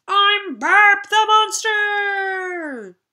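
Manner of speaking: very slow, high, loud monster voice; monster roar. Acted. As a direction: bellow like a proud monster. Bert announces himself.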